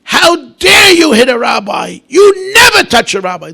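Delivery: high, loud, tearful shout; loud crying rebuke defending the rabbi; outraged scream. Retold or imitated. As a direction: scold her in outrage, near tears. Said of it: "she screamed at the lady".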